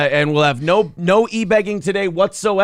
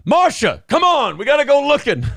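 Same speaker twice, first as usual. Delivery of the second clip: high-pitched hollering; mock calling out; 9 semitones higher than usual. Host playing a searcher calling Marsha, mock high holler. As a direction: call urgently, pitched way up.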